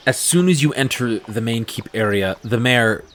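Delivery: ordinary talk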